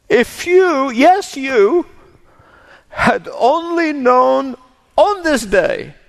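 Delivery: high, slow, grieving plea; grieving lament. Acted. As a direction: weep the words over the city. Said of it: "Please listen to those words again as if you've never heard them before".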